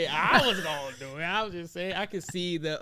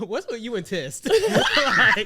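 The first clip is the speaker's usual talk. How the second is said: laughing while teasing; 9 semitones higher, 8 dB louder, slower than usual. Real, not acted. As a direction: tease through the laughter. Teasing about tests, laughing.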